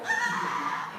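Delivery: high breathy laughing squeal; short high giddy shriek; excited squeal. Shown as a demonstration. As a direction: squeal like you just won. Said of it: "What do you do with your legs?".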